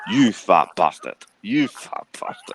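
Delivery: slow mock Scottish taunt; Fat Bastard voice. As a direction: drag out the insult like Fat Bastard. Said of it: "a little bit of a fat bastard".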